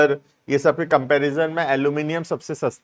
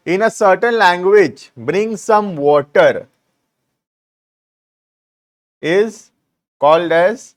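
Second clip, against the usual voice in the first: slow dictation; much slower than usual. Shown as a demonstration. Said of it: "dictating the example slowly".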